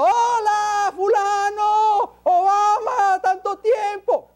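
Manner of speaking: high, shouted hammed-up hello to a friend; imitating a guest shouting hello to the president at a state dinner; mock loud greeting. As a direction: yell it across the room like a rude guest. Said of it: "va a entrar gritando y diciendo".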